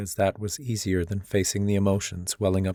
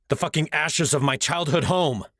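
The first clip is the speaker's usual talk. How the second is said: higher, furious snap; snapped; 6 semitones higher than usual. Acted. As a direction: spit it out in fury. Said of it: "I snapped".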